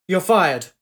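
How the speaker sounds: loud, abrupt sacking; blustering boss throwing someone out; angry dismissal. A narrator's reading. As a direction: fire them on the spot, loudly. Big man sacks an official.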